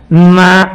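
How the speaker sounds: నా said loud and firm with the tongue; tongue sound na. Shown as a demonstration. Teacher isolating నా, loud and firm. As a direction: strike నా off the tongue. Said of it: "నకారంతో మొదలవుతుంది".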